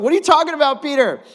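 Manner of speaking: loud astonished challenge to Peter; incredulous outburst. Acted. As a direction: shout your disbelief at Peter.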